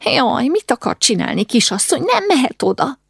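high-pitched, wailing protest; worried, high-voiced scolding; fretting older woman. A narrator's reading. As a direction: lament and plead like a fussing auntie. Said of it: "Erzsi néni ekkor már ott sopánkodott".

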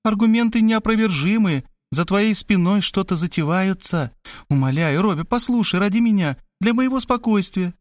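high-pitched pleading sister; urgent, lightly voiced persuasion; coaxing. A narrator's reading. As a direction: implore him in a lifted voice. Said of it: "начала уговаривать меня Кристина".

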